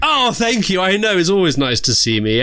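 high, vain camp diva; campy drag queen. Acted. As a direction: preen like a self-loving diva. Host persona.

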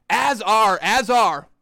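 normal talking voice

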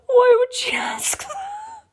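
high, slow, breathless with laughter; laughing whisper-shout. Real, not acted. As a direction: cry it out through laughter. Laughing at the question.

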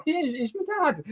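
teasing fake admission. Host winding up fans asking how Gio and Gonzo met, coy high teasing reply. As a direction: drop the joke like a sly confession. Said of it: "I'm always tempted to just be".

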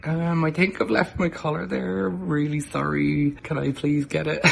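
small, apologetic ask, drawn-out sorry; lightly hammed-up meek request; sheepish. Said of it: "I sheepishly had to go".

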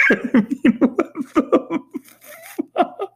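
high squeak through laughter; can't get the words out for laughing; laughing disbelief. Real, not acted. Laughing in disbelief at the strobe-lit scene.

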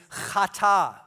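chata with a throaty ch like Scottish loch; chata, guttural Hebrew ch. Shown as a demonstration. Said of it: "you gotta get that ch".

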